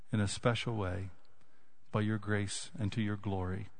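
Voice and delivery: soft, low, hushed prayer. Real, not acted. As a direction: pray quietly, pausing between phrases. Leads a prayer.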